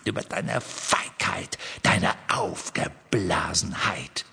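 Voldemort's voice. A narrator's reading. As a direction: taunt Ron coldly as Voldemort. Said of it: "mit Voldemorts Stimme".

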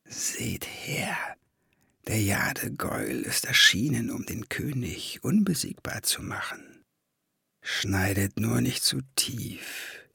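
very soft, ghostly whispering voice; slow, hushed, half-whispered taunt. A narrator's reading. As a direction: whisper it slowly, like voices haunting the memory. Remembered mocking voices, read in whisper.

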